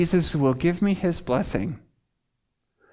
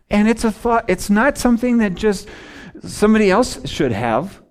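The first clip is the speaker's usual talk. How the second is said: ordinary talk, nothing marked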